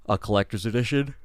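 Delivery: words stretched by a real yawn. Real, not acted. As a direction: say it mid-yawn. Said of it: "Excuse the yawn".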